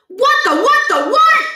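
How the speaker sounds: loud high stammering what the; shocked sputter. Acted. Stuart after the pie hits his face, loud and shocked. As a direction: splutter in total shock.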